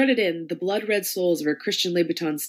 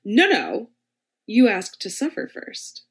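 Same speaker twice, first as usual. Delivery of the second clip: louder, slow reproach; stern scolding domme; 5 semitones higher, 4 dB louder, slower than usual. Acted. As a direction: scold him like a strict mistress. Lady M slaps his hand away.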